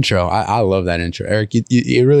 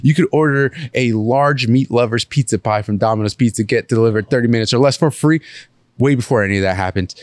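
dramatic intro narration; 4 semitones higher than usual. Acted.

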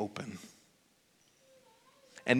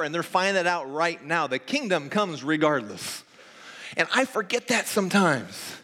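higher-pitched, urgent preacher's drive; fervent preaching; 6 semitones higher, 5 dB louder than usual. Real, not acted. Preacher urging hope on the congregation, fervent and raised. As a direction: press the hope home without letting up.

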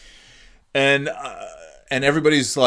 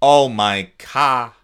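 put-on slow, dropped-R South Boston accent; 7 dB louder, much slower than usual. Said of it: "sounded like they were from South Boston".

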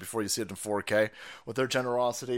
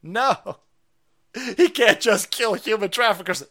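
ordinary talk, nothing marked

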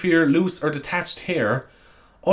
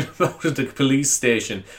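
ordinary talk

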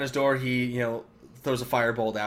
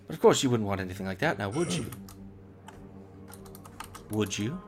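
fast, taunting, trailing into soft questions; teasing devil; 4 semitones lower, 6 dB quieter, faster than usual. Acted. Devil taunting the student, fast and teasing. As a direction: needle him until he cracks. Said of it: "So he goes".

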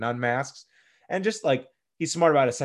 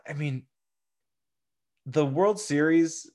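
ordinary talk, nothing marked

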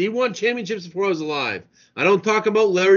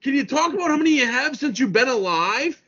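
higher-pitched, heated argument; exasperated; 7 semitones higher than usual. Real, not acted. Host arguing with Yankees fan about old titles, exasperated. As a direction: cut the fan off in high exasperation.

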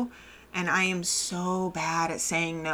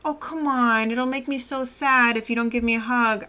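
higher, pleading mock whine to a child; guilt-tripping relative; 5 semitones higher than usual. Relative guilt-trips the little girl into a hug, in a mock whine. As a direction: lay the guilt on thick, mockingly. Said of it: "I don't like the manipulate".

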